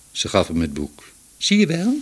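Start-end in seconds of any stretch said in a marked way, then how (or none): 1.4-2.0 high-pitched triumphant cry. A narrator's reading. Young man gets the book back, triumphant. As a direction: crow it, proven right. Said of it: "riep hij triomfantelijk".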